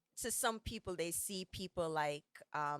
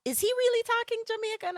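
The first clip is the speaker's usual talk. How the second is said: much higher, louder incredulous voice; a surprised outsider, pitched way up; incredulous question; 7 dB louder than usual. Retold or imitated. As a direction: act out the stunned asker, high and loud. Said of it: "somebody was like".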